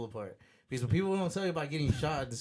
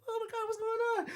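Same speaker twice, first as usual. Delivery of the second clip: put-on high, panicked girl's voice on the phone; girlfriend on the phone. Retelling the shooting, he voices his girlfriend, high and panicked. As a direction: squeal it like his scared girlfriend.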